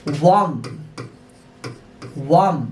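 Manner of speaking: slow, clear demo of वार्म with a v sound. Shown as a demonstration. Teacher drilling students on the v sound in वार्म. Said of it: "ये देखिए व आया".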